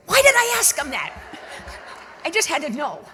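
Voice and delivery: high, loud laughing self-mockery. Real, not acted. Laughing at her own nosy question to the driver, laughing loudly. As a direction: burst out laughing at yourself.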